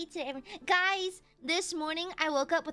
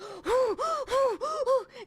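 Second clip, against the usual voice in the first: gasp; 7 semitones higher, 4 dB louder than usual. Retold or imitated. Panicked wake up.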